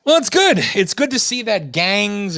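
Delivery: dry sarcasm that jumps way up in pitch, then slows; sarcastic praise. Real, not acted. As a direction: fake cheer over something awful. Sarcastic reply about gang code.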